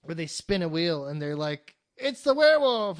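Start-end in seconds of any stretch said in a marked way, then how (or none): 2.0-3.0 loud, high mock game-show cry; excited announcement. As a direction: announce it like a big reveal. Mimics excited wheel-spin announcement.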